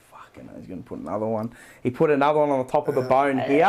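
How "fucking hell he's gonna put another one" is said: low, soft, fast grumble under breath; low, quick, hushed dread; muttered aside. Retold or imitated. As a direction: grumble it to yourself. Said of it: "I'm like".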